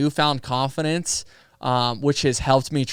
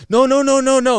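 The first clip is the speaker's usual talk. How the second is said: loud, much higher mock plea of no no no; imitating the umpire and catcher urgently holding him back; mock umpire calling no; 11 semitones higher, 7 dB louder than usual. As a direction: voice the umpire waving it off, loud and high.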